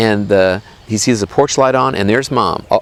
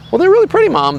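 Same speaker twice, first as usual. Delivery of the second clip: put-on very high, louder child voice; little boy; 4 dB louder, faster than usual. Boy answers Mom about the eels, high child voice. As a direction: pipe up as a pleased little boy.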